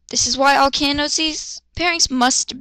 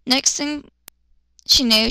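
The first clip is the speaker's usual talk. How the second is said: ordinary delivery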